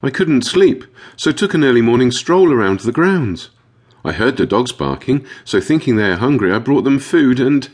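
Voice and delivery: distressed dog owner explaining; upset Tulip. A narrator's reading. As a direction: recount it in a high, upset voice. Upset owner explains before sobbing.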